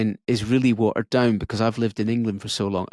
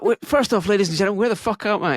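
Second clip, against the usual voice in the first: higher, quick stage delivery; stand-up opening line; 7 semitones higher, much faster than usual. Retold or imitated. Comic repeating his gig opening, higher stage delivery. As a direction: call out to the room from the stage. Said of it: "so I was like".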